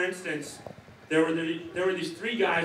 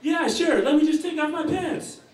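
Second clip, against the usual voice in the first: high, loud, breezy reply; the second roommate answers, high and carefree; higher roommate voice; 13 semitones higher, 4 dB louder than usual. Retold or imitated. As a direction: toss it off brightly as the other guy.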